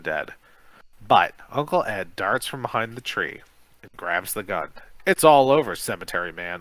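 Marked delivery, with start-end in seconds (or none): 5.1-6.6 loud call, dropping on the name. Acted. Uncle confronting the cemetery man, loud call. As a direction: call it across the clearing. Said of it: "Uncle Ed calls".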